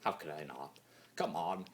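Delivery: ordinary delivery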